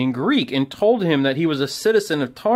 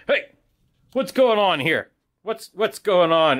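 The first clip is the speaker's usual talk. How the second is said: soldier voice; 7 semitones higher than usual. Acted. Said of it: "All right, here he goes".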